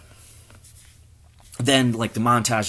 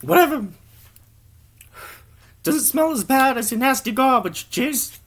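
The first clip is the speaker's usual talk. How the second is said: much higher, louder dismissive comeback; high snotty retort; 4 dB louder than usual. Acted. The other character snapping back, high snotty retort. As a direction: fire back in a high put-on voice.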